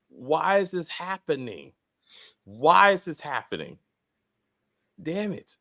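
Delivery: slow, exasperated questioning; irritated. Real, not acted. Host demanding an explanation for the fake props, irritated. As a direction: draw out the question in real annoyance. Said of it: "I'm getting really, really irritated".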